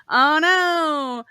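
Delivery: hammed-up crying "oh no"; mock wail. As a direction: ham up the prince's despair. Mock wail after laugh.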